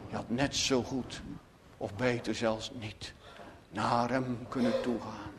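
low, hushed insinuating voice; imitated whisper, very quiet with pauses; retold whisper. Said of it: "in zijn hart fluistert".

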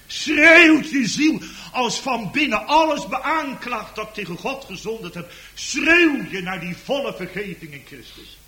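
impassioned pulpit shout; preaching at full force. Acted. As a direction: strain the voice like a crying soul. Preaching on the crying soul.

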